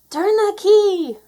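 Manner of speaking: high, loud, drawn-out command; urgent shout. A narrator's reading. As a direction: yell it to get the engine going. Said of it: "shouted Minnie".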